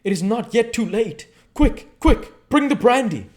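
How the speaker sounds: high, loud, desperate cry for brandy; urgent cry. Acted. Professor crying out to his friend at the sickbed, urgent loud cry. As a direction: shout it out as if a life hangs on seconds. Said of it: "he cried out to me".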